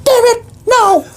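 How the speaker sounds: high fast bird voice; squeaky bird voice. Acted. Bird PD refuses help, high fast squeaky voice. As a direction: chirp it out fast.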